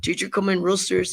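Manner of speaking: ordinary talk, nothing marked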